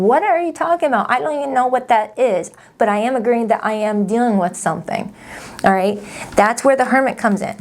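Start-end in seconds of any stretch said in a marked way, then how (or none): 0.0-2.5 higher-pitched, mildly incredulous questioning; puzzled viewer. Acted. Imagined viewer questioning the tarot reader, mildly incredulous. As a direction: voice a viewer who's lost. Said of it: "if you're watching this thinking".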